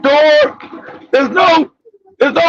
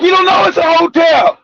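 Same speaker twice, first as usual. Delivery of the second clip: normal talking voice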